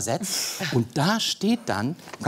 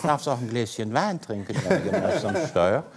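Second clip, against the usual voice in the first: easygoing Rhineland lilt with chuckles; Rhineland dialect; 4 semitones lower than usual. Shown as a demonstration. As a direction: put on a jolly Rhenish accent. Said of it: "war durch Rheinland". (gemütlicher rheinischer Dialekt)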